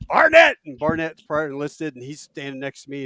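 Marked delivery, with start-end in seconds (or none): 0.1-0.6 much higher, loud barked name; roll call shout. Retold or imitated. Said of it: "then he goes".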